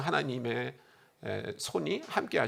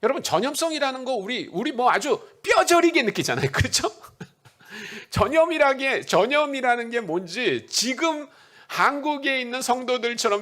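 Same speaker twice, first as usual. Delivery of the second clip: higher and louder, pressing the congregation; fervent preacher, rising to the crowd; preaching at full force; 7 semitones higher, 4 dB louder than usual. Acted. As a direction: drive it home like a rousing sermon.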